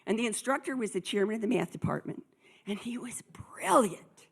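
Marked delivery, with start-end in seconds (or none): 2.7-4.3 breathy whispered aside; hushed, slow admiring aside. Shown as a demonstration. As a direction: drop to a slow whisper, confiding. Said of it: "whispered aside".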